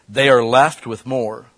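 loud, slow, every word weighed; slow emphatic stress. Real, not acted. Preacher hammering the point home, slow and loud. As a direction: lean hard into it.

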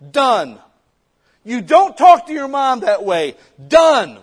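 loud, high, firm household decree; stern dad laying down law. Pretend. Father tells his family the new rules, loud and stern. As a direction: bark the new rules, slow and final.

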